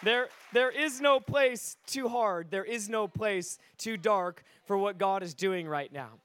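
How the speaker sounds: slow, forceful pulpit declaration; emphatic proclamation. Real, not acted. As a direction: proclaim it to the whole room, stretching each phrase. Proclaims the point to the congregation.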